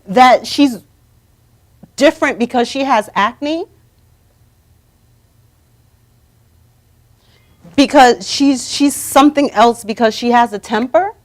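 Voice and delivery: righteous scolding on someone's behalf. Pretend. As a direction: tell them off in outrage, punching key words. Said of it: "go over there and curse them out".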